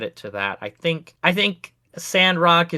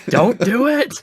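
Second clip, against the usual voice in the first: much higher, louder mock warning; insistent mock warning; 10 semitones higher, 5 dB louder than usual. Guard warning the player more urgently, mock alarm. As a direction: escalate the playful caution, pitched right up.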